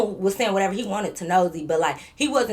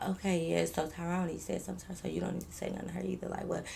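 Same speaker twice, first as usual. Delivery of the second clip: hushed aside; 2 semitones lower, 14 dB quieter than usual. Retold or imitated. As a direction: murmur it so only your friend hears. Hushed aside to friend in car.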